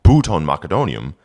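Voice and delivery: put-on careful Old English recitation. Shown as a demonstration. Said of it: "reading Old English text aloud".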